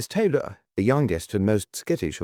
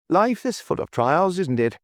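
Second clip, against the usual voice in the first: cheerful; 9 semitones higher, 3 dB louder than usual. A narrator's reading. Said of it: "said Anthony cheerfully".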